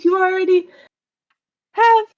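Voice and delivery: high-pitched, halting reply; hesitant spider creature. Acted. Spider breaking bad news to stranded visitors, hesitant and high.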